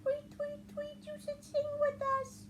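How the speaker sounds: very high, quick chirpy bird voice; chirping birds. Acted. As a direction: tweet it out like a little bird. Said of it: "said the birds".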